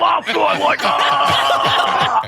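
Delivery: a Scottish girl in bed, a hammed-up Scottish accent; Scottish pirate. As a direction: ham up a pirate-like Scottish brogue. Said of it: "that sounds like a pirate or something".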